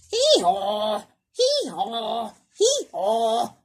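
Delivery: mock hee-haw braying; donkey bray sobs. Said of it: "they cried".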